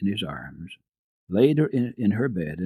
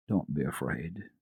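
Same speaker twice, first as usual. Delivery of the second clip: kindly; 3 semitones lower than usual. A narrator's reading. Doctor comforting Heidi on the stairs, kindly. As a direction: comfort a scared child. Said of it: "he said kindly".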